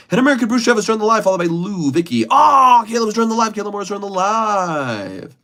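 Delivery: loud, fast, high play-by-play announcing of who joined; sportscaster voice. Pretend. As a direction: call the joins like a hyped sportscaster. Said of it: "did it like a sportscaster".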